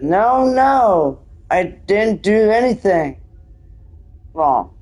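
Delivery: slow, halting protest with a break before the last word; slow acted reading. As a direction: plead innocence slowly, phrase by phrase. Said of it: "Sorry, I read it so slowly".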